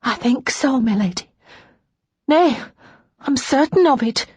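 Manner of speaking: high maid's voice; earnest maid. A narrator's reading. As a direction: grow surer as you answer. Maid Naomi voiced, growing surer.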